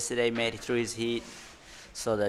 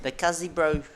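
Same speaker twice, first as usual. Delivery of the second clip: put-on Kiwi bro accent; 5 semitones higher than usual. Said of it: "No, he doesn't even speak like that".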